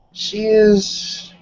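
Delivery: normal talking voice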